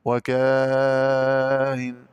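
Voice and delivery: وكاهن with a very long held aa, then eased hamza; very slow "wakaaaa-in" for وكاهن; long madd. Shown as a demonstration. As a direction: chant the aa of وكاهن long and steady. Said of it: "مع المد والقصر".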